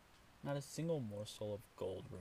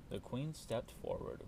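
plain reading voice

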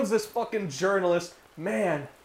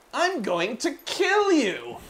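lightly voiced creepy doll; 7 semitones higher, slower than usual. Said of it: "the crusty doll's like".

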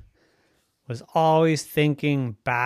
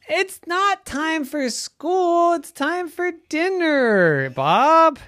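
much higher, slow, louder calling mom; exasperated mommy; 13 semitones higher, 4 dB louder than usual. Acted. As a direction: call him back like a fed-up mom. Mommy calling Bob for dinner.

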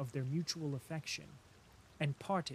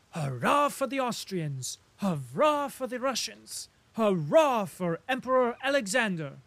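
cheerful cheering; 6 semitones higher, 6 dB louder than usual. A narrator's reading. Rustov cheering the German.

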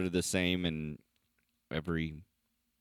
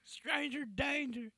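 put-on high old voice; 8 dB quieter, slower than usual. Echoing the confused old man bit.

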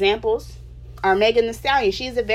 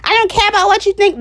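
mocking rude retort; 11 semitones higher, 6 dB louder than usual. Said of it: "without somebody saying".

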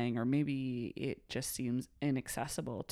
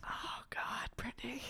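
whispered disdain; 7 semitones higher, 10 dB quieter than usual. Real, not acted. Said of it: "I shouldn't say it like that".

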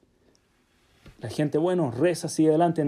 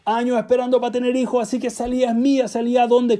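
raised, louder pleading voice, pitched up high; insistent plea; 6 semitones higher, 5 dB louder than usual. Acted. Desperate man demanding the aliyah from the guest, loud and high. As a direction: beg loudly as if the honour is owed to you.

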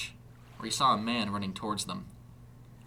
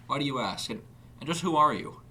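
firm, higher and louder challenge; firm questioning; 6 semitones higher, 5 dB louder than usual. A narrator's reading. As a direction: demand firmly who he is. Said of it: "Larkins asked firmly".

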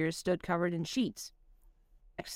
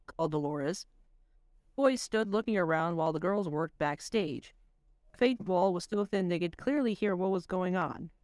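ordinary delivery

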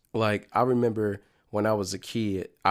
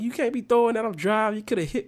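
much higher mock scolding mom; 10 semitones higher than usual. Mom scolding her son for throwing the Game Boy, high mock scold. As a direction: play the angry driving mom, pitched up. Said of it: "my mom was yelling".

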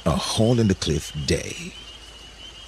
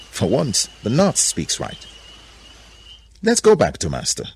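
raised, urgent pitch; decisive Tigress; 6 semitones higher than usual. A narrator's reading. Tigress urging companions back to master, raised urgent voice. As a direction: press them higher, building in volume.

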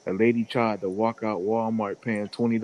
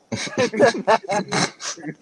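laugh; 10 semitones higher than usual. Real, not acted. Laughing at own story.

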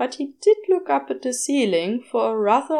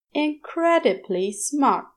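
plain reading voice

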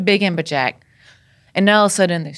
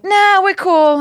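high, loud brush-off; mock nonchalance; 13 semitones higher, 6 dB louder, slower than usual. Friend refusing to drop fish among sharks, mock nonchalant. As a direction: imitate an overconfident friend waving away danger.

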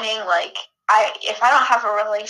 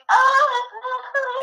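high-pitched drawn-out "oh"; imitated emotional squeal; acted swooning wail. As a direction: wail it high, as if moved all over again. Acting her reaction at each reading.

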